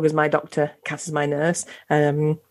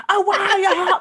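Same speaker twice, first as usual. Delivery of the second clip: high, laughing voice of a delighted dog; dog's voice; 11 semitones higher than usual. Retold or imitated. Host voicing her dog, high and laughing. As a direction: squeal it out like a happy dog. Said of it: "they're there going".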